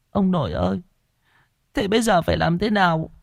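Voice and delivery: high-pitched, whimpering plea; tearful whimper. A narrator's reading. Frightened Ất begs grandfather for what to do, whimpering. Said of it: "Chú Ất mếu máo".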